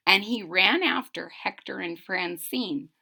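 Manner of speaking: plain reading voice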